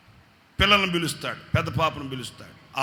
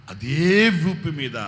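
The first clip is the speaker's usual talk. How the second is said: అదే వీపు మీద drawn out very slowly, louder; emphatic slow; 3 semitones higher, 8 dB louder, much slower than usual. Acted. Pastor building the pattern, slow and louder.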